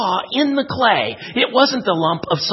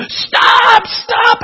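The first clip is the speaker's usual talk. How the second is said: loud, high yell of pain; acted desperate shout; crying out; 13 semitones higher, 9 dB louder than usual. A narrator's reading. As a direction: shriek it as the hurting clay, begging for relief. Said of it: "I cried".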